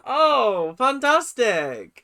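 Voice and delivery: "fantastic" stretched slowly, loud and high with fake glee; loud sarcastic fantastic. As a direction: belt out "fantastic" as mock praise. Sarcastic praise of late rehearsal.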